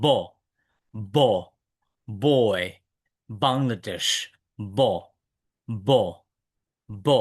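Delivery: "ব, বয়, বাংলাদেশ" from closed lips with no air escaping; b without air. Shown as a demonstration. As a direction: say each "ব" separately, lips shut first, no puff. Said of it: "কিন্তু বাতাস বের হবে না".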